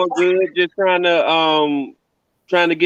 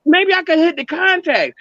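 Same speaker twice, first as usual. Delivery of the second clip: imitating mama; 10 semitones higher than usual. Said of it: "She's like".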